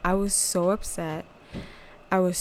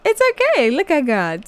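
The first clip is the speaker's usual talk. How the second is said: much higher and louder with joy; joyful exclamation; 6 dB louder than usual. Retold or imitated. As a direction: burst out in happy praise. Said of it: "I was like".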